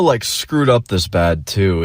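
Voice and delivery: ordinary delivery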